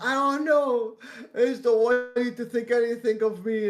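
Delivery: high, whiny tearful voice; mock crying. Friend fears what the guys think, mock-crying whine. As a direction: imitate a friend fretting through tears. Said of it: "why are you crying now?".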